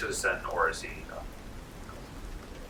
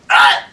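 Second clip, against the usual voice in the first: sudden yelp as the arrow pins him; a loud startled cry of pain; 2 semitones higher, 17 dB louder than usual. Acted. Guard yelping when the arrow hits. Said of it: "and he goes".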